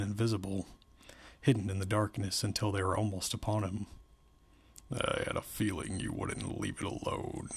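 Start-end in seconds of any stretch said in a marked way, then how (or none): 4.9-7.5 much lower, creaky menacing guard; odd, smiling guard. A narrator's reading. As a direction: drop low, as if through an uneven smile. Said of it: "he said with an odd, uneven smile".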